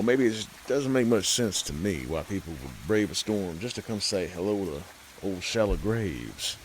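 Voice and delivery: ordinary delivery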